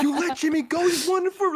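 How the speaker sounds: high-pitched mock outrage; Chase. Partner scolding the new cop, mock anger. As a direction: play an incredulous angry cop, pitched right up. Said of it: "Chase's anger is very".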